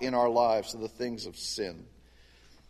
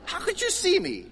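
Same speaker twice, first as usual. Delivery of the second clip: put-on high, laughing, bewildered little boy; child's amazement; 14 semitones higher than usual. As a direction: play his younger self, baffled and amused. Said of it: "I was like".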